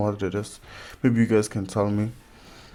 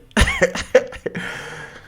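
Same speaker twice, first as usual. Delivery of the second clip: high breathy laugh; 14 semitones higher, 4 dB louder than usual. Real, not acted. Laughing after a joke.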